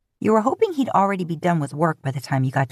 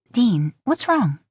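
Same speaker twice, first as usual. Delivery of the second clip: higher, slow worried question; lightly voiced Lisa; 6 semitones higher, slower than usual. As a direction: ask with growing annoyance.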